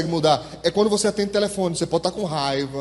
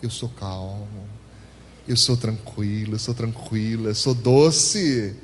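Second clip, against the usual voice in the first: mock sweet calm; 7 semitones lower, slower than usual. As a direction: play a suddenly meek angry person on the phone. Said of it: "aí você imediatamente".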